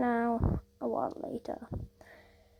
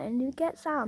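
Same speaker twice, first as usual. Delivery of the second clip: ordinary delivery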